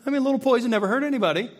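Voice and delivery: high-pitched, breezy dismissal; mock easygoing. Diner accepting poison on his food, mock casual. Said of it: "you're not gonna be like".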